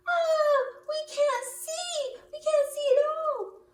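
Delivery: very high, loud panicking bird; high and loud frightened cry; panicked high cry. Acted. Birds panic in the dark.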